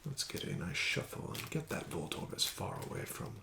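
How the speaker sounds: ordinary talk, nothing marked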